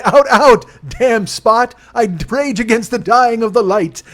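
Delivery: high, loud, hammed-up theatrical declaiming; mock Shakespearean recital. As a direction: ham it up like a tragic stage actor. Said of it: "And I say".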